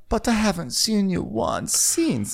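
high, slow put-on voice; Diana. Diana protesting to Garcelle, high slow mock complaint. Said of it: "And Diana's like".

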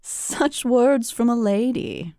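slow, teasing fae voice; mocking Rhysand. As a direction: tease her with slow mockery. Reading Rhysand's line.

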